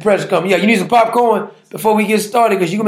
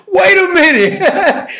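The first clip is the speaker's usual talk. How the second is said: laughing disbelief; 9 semitones higher, slower than usual. Real, not acted. Amused shock at mockery.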